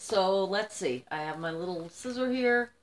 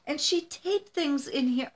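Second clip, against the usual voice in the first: teary voice; 6 semitones higher, slower than usual. Real, not acted. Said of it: "now I am crying".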